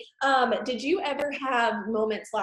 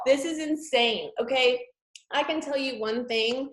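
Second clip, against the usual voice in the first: normal talking voice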